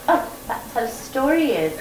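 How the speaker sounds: put-on light British accent, unbothered; a hint of British calm in the guide's reply; mock British matter-of-fact. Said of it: "she was so British".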